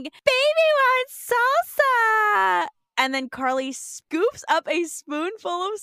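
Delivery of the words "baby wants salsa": put-on loud, high, drawn-out baby yell; hammed-up yelling baby demand; Spencer. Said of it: "he's yelling".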